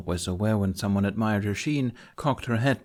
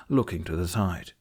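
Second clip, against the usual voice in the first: plain reading voice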